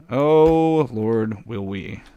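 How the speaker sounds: ordinary talk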